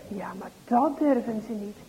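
ordinary delivery

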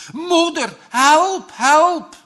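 high, loud cries for mother; desperate scream. Acted. Bram in the icy water calls for his mother, screaming. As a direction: scream for your mother in mortal fear. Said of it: "schreeuwt ook Bram in doodsgevaar".

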